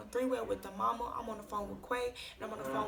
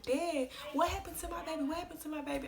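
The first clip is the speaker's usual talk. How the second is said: higher, louder retold cry of alarm; shocked, distressed granny; 6 semitones higher, 4 dB louder than usual. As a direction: voice a granny's alarmed disbelief, pitched up. Retold granny hears of death.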